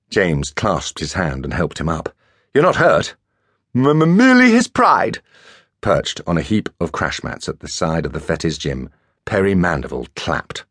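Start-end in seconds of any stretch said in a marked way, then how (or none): 2.5-5.2 much higher, stuttering toff voice; stammering schoolboy. A narrator's reading. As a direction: squeak out the stammered joke. Concerned question then stammering reply.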